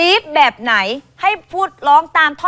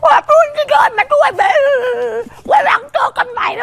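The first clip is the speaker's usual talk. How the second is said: high, loud cartoon dog talk; Scooby Doo voice; 12 semitones higher, 3 dB louder than usual. Shown as a demonstration. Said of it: "คุณลองทำอีกที".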